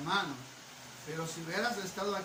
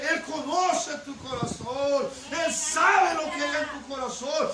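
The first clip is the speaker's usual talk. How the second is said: rising, louder preaching cadence; building sermon; 6 semitones higher, 7 dB louder than usual. Acted. Pastor preaching to the congregation about the heart, building to full force. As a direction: build the repeated lines into a loud climax.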